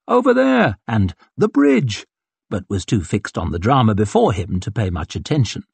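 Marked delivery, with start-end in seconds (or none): 0.1-0.8 high-pitched distant call; crowd call. A narrator's reading. Voices in the hubbub beyond, high calls. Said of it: "picked out muttered calls of". 1.4-2.0 high-pitched distant call; crowd call. A narrator's reading. Voices in the hubbub beyond, high calls. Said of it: "picked out muttered calls of".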